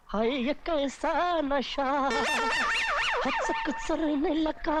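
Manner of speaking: high, drawn-out swooning rhapsody; dreamy raving, lost in infatuation; lovestruck babbling. Acted. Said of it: "आंखें बंद किए क्या बड़बड़ा रहा है".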